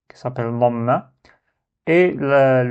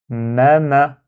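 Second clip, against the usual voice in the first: ma ma blended in one flowing breath; ma ma said fluently and joined; smooth fluent reading; 4 dB louder than usual. Shown as a demonstration. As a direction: read ma ma smoothly as one unit. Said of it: "avec fluidité pour avoir les deux lettres d'un coup".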